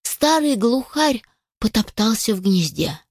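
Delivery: plain reading voice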